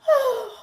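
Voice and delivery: high surprised gasp; sharp gasp. Real, not acted. Catching herself after admitting her relief, a gasp. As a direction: gasp as if caught.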